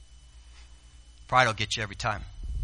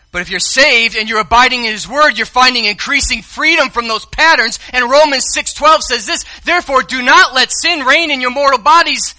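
loud, high, rising preacher's cry; full-throated sermon peak; preaching at full force; 10 semitones higher, 10 dB louder than usual. Acted. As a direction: lift the room with a shout of freedom.

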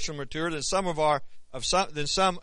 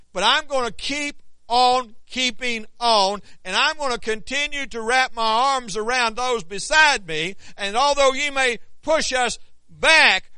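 rising, louder fiery preaching; 7 semitones higher, 9 dB louder than usual. Acted. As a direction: build it up, preacher fire. Preaching at full force.

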